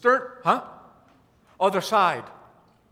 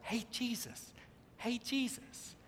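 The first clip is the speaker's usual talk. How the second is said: very soft, polite little call; mock timid call; 2 semitones higher, 16 dB quieter than usual. Disciples meekly waking Jesus in a storm, hushed and casual. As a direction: barely try to wake him. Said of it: "they're not going".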